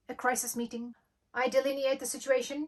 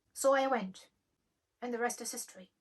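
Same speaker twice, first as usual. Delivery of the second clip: ordinary talk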